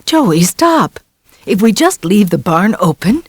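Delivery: mother calling her son back. A narrator's reading. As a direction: stop him from running off.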